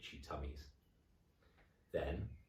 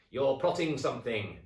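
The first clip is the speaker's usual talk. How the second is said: high, loud accusing hag voice; a loud, high witchy jab; sneering old wife; 7 semitones higher, 7 dB louder than usual. Acted. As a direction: needle him like a nasty old wife. Mrs Twit accusing husband.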